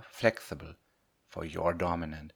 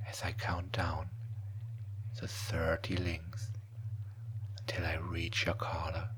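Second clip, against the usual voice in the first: right in your ear; 4 dB quieter than usual. Acted. Hypnosis trance induction, whispered.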